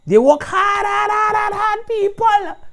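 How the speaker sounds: rising shout of praise, hard repeated; loud praise. Real, not acted. Host hyping the team to listeners, loud shout.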